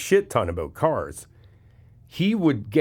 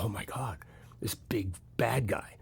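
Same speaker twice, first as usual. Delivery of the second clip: hushed, slowed, faked awe; mock scared whisper; 12 dB quieter than usual. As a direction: whisper as if spooked by a bully. Mock intimidated by bad guy.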